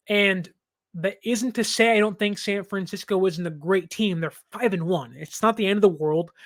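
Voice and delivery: usual speaking voice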